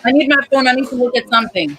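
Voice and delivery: loud, high call across the house. Real, not acted. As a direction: call out an urgent request, quickening. Calling out for her phone.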